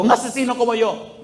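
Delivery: imitated anguished outburst; mock yell. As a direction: cry out as the anguished man. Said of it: "Y él gritaba".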